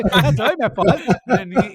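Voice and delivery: laughing while telling. Real, not acted. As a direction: chuckle through the punchline. Laughing as he finishes story.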